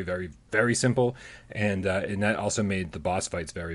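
ordinary talk

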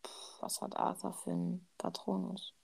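whispered to herself; whispering. Real, not acted. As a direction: whisper while searching memory. Racking her memory, under breath.

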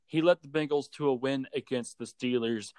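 ordinary talk

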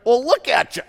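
mock him like an amused wife; high, loud mocking Eve; Eve, teasing. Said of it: "And she's like".

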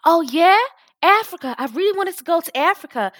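high, bright-eyed fake American accent; mock American accent. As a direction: gush like an American tourist. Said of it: "You and this your Shakwara accent".

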